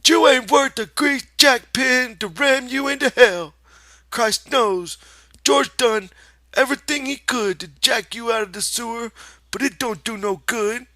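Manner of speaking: high, loud contemptuous shout; shouting rabbit. Acted. The rabbit rants at Lenny, loud high shout. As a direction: rant at him.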